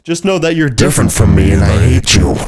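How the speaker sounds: very loud, distorted mock bellow; hammed-up furious shout; hateful outburst. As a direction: explode suddenly into a roar. Acted soothing turns to hateful shout.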